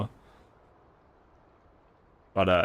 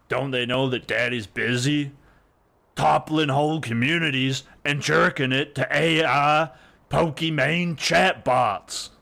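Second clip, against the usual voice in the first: cocky, raised, slowed mock swagger; smug boast; 5 semitones higher, 4 dB louder than usual. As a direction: play the arrogant daddy streamer. Mock boastful self-description.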